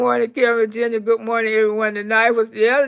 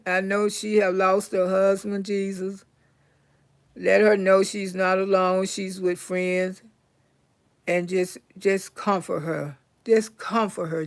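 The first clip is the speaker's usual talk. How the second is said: prayer; 4 semitones lower, 4 dB quieter than usual. Acted. Prays for a grieving viewer.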